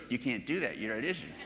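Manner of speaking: soft, low imitation of a child's ruling; deadpan child, retold. Granddaughter telling her brother he can't move, quiet deadpan. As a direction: shut it down quietly, mock matter-of-fact.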